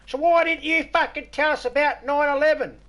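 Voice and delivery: high, loud, squeaky viewer voice; mock outraged viewer. Mocking a viewer who asks about nine eleven, high and loud. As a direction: screech the silly question as the viewer. Retelling a viewer's absurd question.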